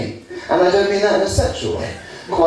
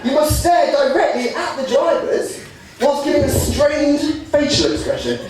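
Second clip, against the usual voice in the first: mock rules; 8 semitones higher than usual. Mock rulebook recitation.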